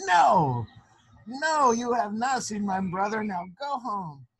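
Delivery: loud, high no, then slow go home; stern dismissal. Acted. Sister dismissing the two proud sisters, loud and stern. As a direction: send them away sternly.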